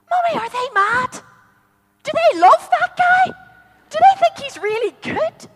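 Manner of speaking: put-on a wide-eyed girl baffled by the crosses; little girl voice. Jessica asks mummy about the crosses, high and puzzled.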